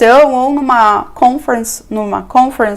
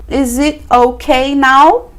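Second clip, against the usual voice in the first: slow model question; 3 semitones higher, much slower than usual. Shown as a demonstration. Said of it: "Aí depois de um tempinho".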